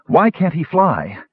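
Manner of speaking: high-pitched, urgent demand. A narrator's reading. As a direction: demand an answer, frantic for a reason. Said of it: "she demanded".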